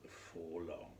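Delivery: ordinary delivery, nothing marked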